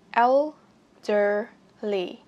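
put-on "Elderly" very slowly in separate syllables; el, der, ly, each stretched with a gap; slow syllable breakdown. Shown as a demonstration. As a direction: break "Elderly" apart for learners. Word broken into slow syllables.

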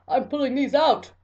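loud, high mock-gleeful dentist; excited character voice. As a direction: crow it as the thrilled dentist. Voices the excited dude in illustration.

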